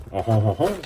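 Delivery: loud, put-on French chortle; hammed-up ho-ho laugh with French flair; mock French laugh. Crafter capping her French act, loud mock French laugh.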